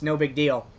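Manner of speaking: ordinary talk, nothing marked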